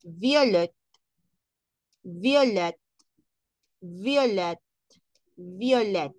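violet said vyo-leh, silent t; French violet. Shown as a demonstration. Teacher has class listen to French violet. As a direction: say violet with no final t.

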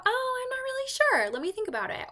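high, loud hesitant hostess voice; a hammed-up unsure hostess. Hostess unsure which friends might join, high and hesitant.

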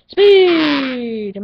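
loud, long rallying shout; drawn-out yell. Pretend. Gamer urging on speed, loud yell. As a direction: stretch it into a power-up cry.

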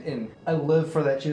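ordinary delivery

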